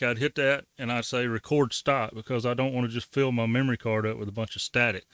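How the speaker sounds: usual speaking voice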